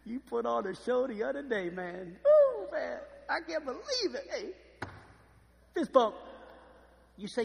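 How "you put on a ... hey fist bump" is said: high-pitched, wowed fanboy hype; amazed buddy. Acted. Lazarus marveling to Jesus at the table, amazed. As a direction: gush to your friend in hammed-up awe.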